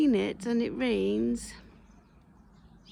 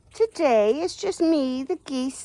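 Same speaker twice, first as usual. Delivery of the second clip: ordinary talk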